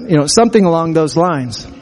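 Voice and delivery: normal talking voice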